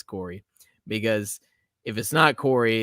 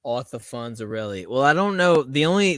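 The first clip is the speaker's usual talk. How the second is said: ordinary talk, nothing marked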